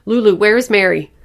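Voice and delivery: loud, high, urgent demand; frantic shout. A narrator's reading. Mother demanding her missing baby from Lulu, frantic shout. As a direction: shout it at the child in panic.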